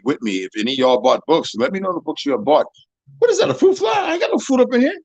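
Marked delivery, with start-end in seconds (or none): 3.2-5.0 surprised. Real, not acted. Startled by fruit fly.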